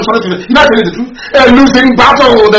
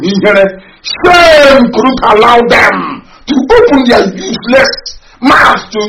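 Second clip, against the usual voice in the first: very slow, voice breaking into sobs; crying outrage; much slower than usual. Real, not acted. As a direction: drag the words out through tears.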